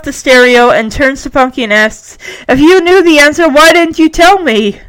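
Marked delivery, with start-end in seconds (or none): 2.5-4.8 louder, higher indignant question; exasperated. Acted. Henry confronts Punky over the answer, exasperated. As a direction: demand it with wounded frustration.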